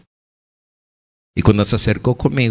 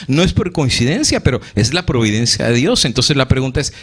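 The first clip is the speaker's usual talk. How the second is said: usual speaking voice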